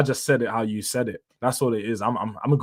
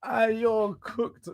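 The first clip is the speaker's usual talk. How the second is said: much higher, drawn-out teasing voice; slow mock taunt; 10 semitones higher, 4 dB quieter, much slower than usual. Streamer taunting chatter Ranger, slow and high. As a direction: stretch out the taunt in a silly high voice.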